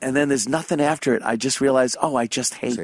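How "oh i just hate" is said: softer, private realization; mumbling to self. Retold or imitated. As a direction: say it only to yourself. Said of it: "I'm just mumbling to myself".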